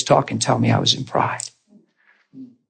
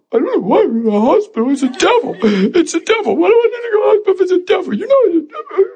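high-pitched, tearful mock mumbling; pitiful imitated weeping, words running together; mumbling and crying; faster than usual. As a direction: blubber it in a hammed-up whine. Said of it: "I'm mumbling".

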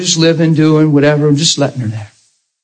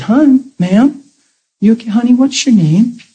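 raised, higher caring call to a stranger; concerned calling out; 8 semitones higher than usual. Retold or imitated. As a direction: call out gently but much higher, worried.

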